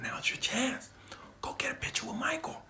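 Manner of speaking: high whispered egging on; urging whisper. Retold or imitated. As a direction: push him on in a whisper. Friends urging him.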